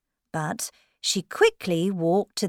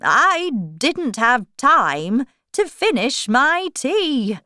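higher, loud, swooping voice; Hatter; 7 semitones higher, 7 dB louder than usual. Acted. Hatter excusing himself to the king, high swooping voice. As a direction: protest with a loud up-and-down lilt. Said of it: "he said".

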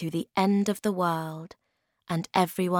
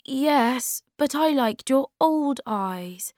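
put-on high-pitched, reluctant young troll; raised character voice, mildly unhappy; unhappy Moomin Troll; 8 semitones higher, slower than usual. A narrator's reading. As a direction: let him down unhappily in a raised voice. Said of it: "said Moomin Troll unhappily".

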